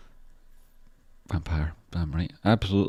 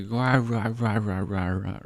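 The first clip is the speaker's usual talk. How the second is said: monkey chest-beating; 4 semitones higher, slower than usual. Retold or imitated. Streamer mimicking the monkey in the hype video, playful ape grunts. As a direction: ham up a chest-thumping monkey.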